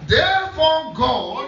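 very slow, high proclamation; emphatic scripture echo. Real, not acted. Preacher echoes the reader to the congregation, drawn-out emphatic preaching. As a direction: draw both words right out.

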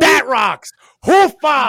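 high, loud Pacino shout with a hoofa; Al Pacino impression. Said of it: "with that as Pacino".

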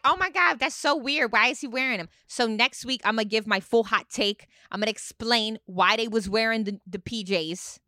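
emphatic, defensive housewife impression; hammed-up reality-star indignation; Teresa. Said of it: "Your Teresa's so good".

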